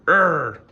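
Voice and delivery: fed-up grunt; frustrated groan. Real, not acted. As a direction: groan at the interruption.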